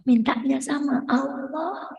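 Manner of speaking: slow, soft and deliberate; quiet slow insistence; slow soft emphasis. Acted. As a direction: lay it down gently, slowly. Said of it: "stressing the lesson".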